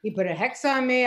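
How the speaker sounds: normal talking voice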